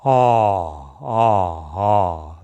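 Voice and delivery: open-throated ah. Shown as a demonstration. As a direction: drop the jaw and open the throat wide. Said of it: "this big open-throated".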